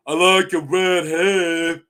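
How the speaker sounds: slow, loud, dopey idiot voice; Dunk. Retold or imitated. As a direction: blurt it out like a big dimwit. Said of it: "like an idiot".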